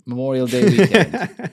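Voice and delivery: "Memorial Day weekend" said through a laugh, with Day added; laughing, corrected phrase. Real, not acted. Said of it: "How do you, Memorial weekend?".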